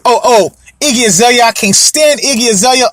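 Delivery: ordinary talk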